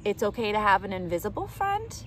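lively storybook read-aloud voice; reading to children. Acted. Reading a picture book aloud to kids, bright and lively. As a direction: read it out brightly for little listeners.

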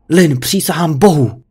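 restrained angry shout; a warning called out in contained fury; furious shout, narrated. A narrator's reading. Husband yelling at wife through the door, restrained furious shout. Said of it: "Křičel jsem a bušil pěstí".

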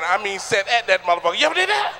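ordinary talk

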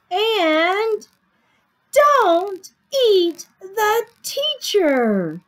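high, loud, spaced-out rule; emphatic climax. A narrator's reading. Sammy listing lessons to his mother, emphatic. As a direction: land each word big and slow.

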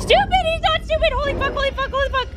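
high, loud, breakneck panic; frantic yelling. Real, not acted. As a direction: yell frantically mid-chase. Frantic escape in game.

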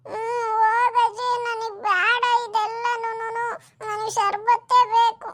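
high, loud, drawn-out whine; tearful stubborn child. Acted. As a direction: cry and refuse like a small kid. Child crying, refusing the drink. (ಅಳುತ್ತಾ ಹಠ ಮಾಡುವ ಮಗು)

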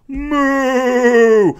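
loud high mooing like a talking toy; toy cow moo. Retold or imitated. Describing the See 'n Say toy to viewers, loud high toy moo. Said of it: "and it'd say".

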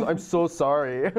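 mock apology. Friend jokingly imitating Patton Oswalt bothering gym-goers, mock apology. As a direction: stretch the sorry as a bit. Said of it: "He goes".

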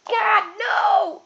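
high-pitched comic cry of protest; outraged shout. Acted. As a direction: shout it in mock horror. Outraged reaction to the insult.

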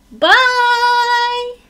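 high, loud, sing-song bye; cheerful loud goodbye. Real, not acted. Teacher waves goodbye to class.

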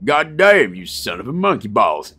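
a hint of a gruff hardboiled American detective, mock; lightly hammed-up tough cop growl, American accent; playful mock noir detective cursing. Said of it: "That, that's my best American, uh, detective voice".

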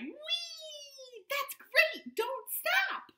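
high excited squeal; Frog cries with glee. Acted. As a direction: cheer the others on, way up high. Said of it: "Frog cried".

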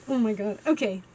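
ordinary talk, nothing marked

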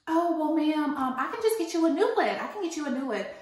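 smooth it over like a nervous barista; high, eager mock customer-service voice; pleading barista. Barista voiced in retold argument.

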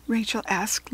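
plain reading voice

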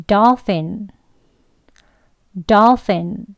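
"dolphin" with the ph said as F; teacher repeating "dolphin" twice; F sound demo. Shown as a demonstration. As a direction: model "dolphin" for learners with F in the middle. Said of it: "같이 해볼게요".

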